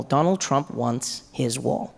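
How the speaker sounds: slow, low drawn-out Donald; slow emphatic. Shown as a demonstration. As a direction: stretch out Donald Trump wants his wall. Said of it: "He wants his wall".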